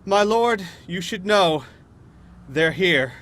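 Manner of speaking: loud, high warning to a master. Acted. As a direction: call out the alarm in character. Villain's servant raises the alarm.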